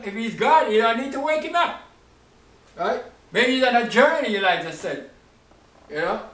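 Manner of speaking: ordinary delivery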